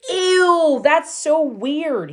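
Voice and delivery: loud high "ew", drawn out slowly; disgusted yell. Acted. Bully seeing her sandwich at lunch, loud disgusted yell. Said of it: "he yelled".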